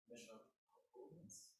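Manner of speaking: normal talking voice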